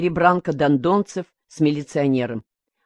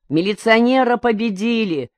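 higher, triumphant crowd shout; 7 semitones higher than usual. A narrator's reading. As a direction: yell the victory. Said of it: "закричали вокруг". (торжествующий крик, выше)

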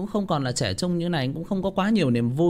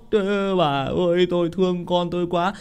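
put-on lamenting old father; 4 semitones higher than usual. Old father misses sick daughter.

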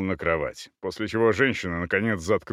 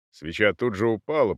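usual speaking voice

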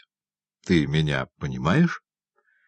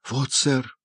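mild cry; 6 semitones higher than usual. Acted. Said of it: "крикнул Оливер".

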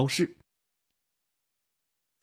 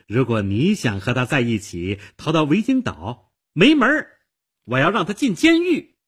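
angry threat; 5 semitones higher than usual. Acted. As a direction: warn the rival, then explode. Threatens her rival and lover.

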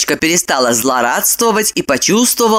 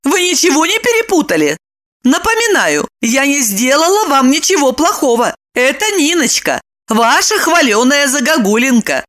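high-pitched, offended woman; indignant; 8 semitones higher than usual. A narrator's reading. Lipochka mocks Roman's boasts of fidelity, indignant. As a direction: scold him in high, mocking indignation. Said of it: "возмутилась Липочка".

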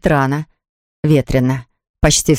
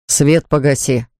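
ordinary delivery

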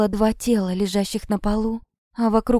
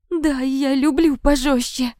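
high, slow, wailing confession; forced out in tearful despair; desperate submission; 5 semitones higher, slower than usual. A narrator's reading. Victim forced to repeat, louder.

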